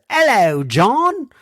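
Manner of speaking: high-pitched mock English greeting; put-on English accent. Host hamming up a listener's email greeting, put-on English accent. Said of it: "I put the ac-, accent on there".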